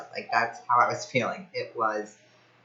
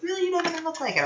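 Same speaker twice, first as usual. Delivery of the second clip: imitating the husband, pitched much higher in surprise; mock disbelief; much faster than usual. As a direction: squeak out his doubting reply, hammed up.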